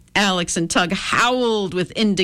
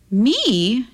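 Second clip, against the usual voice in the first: high, mildly hurt little question; sad question; 7 semitones higher than usual. A narrator's reading. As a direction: ask it with a touch of sadness, pitched up. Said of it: "she asked sadly".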